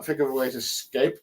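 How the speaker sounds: ordinary delivery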